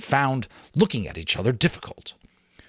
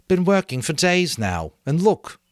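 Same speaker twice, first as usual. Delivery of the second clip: high, loud weary complaint; frustrated boy; 10 semitones higher, 5 dB louder than usual. A narrator's reading. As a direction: vent about days of wasted work. Ralph complains.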